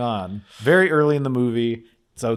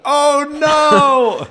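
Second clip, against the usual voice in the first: 1 mock shocked cry; 13 semitones higher, 6 dB louder than usual. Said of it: "I went".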